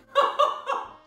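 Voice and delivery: high, airy giggle after a slip; giggling at mixing up oven and onion; breathy laugh. Real, not acted. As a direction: laugh at your own mistake.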